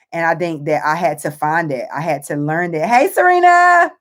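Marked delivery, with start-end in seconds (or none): 2.9-3.9 calling out much higher and louder, voice choked with tears; tearful call. Real, not acted. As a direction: call her name while on the verge of crying.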